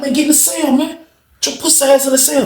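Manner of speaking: high, pushy demand; imitating a threatening inmate; angry command. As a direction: corner him and order him in. Inmate cornering Coach.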